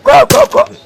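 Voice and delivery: high, sharp shooing cry. Acted. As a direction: chase them off with a high yelp. Shooing someone away.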